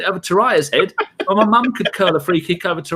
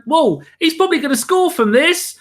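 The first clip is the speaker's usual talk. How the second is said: mock-alarmed whoa, pitched way up; a keeper seeing doom coming, high and rising; hammed-up panic from the keeper watching the wall; Leno in alarm; 11 semitones higher, 3 dB louder than usual. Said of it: "Leno's going".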